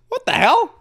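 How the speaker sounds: high, loud disbelief; incredulous exclamation; baffled outburst. Real, not acted. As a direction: shoot up in pitch, stumped. Stumped again in state quiz.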